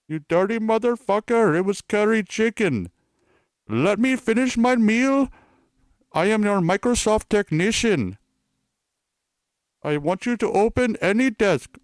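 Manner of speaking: high-pitched, put-on Indian tech-scammer voice; Indian accent. Fake Microsoft technician scolding a customer, high Indian accent. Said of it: "pretend to be, um, Indian".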